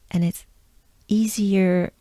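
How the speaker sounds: usual speaking voice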